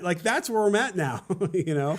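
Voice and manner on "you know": normal talking voice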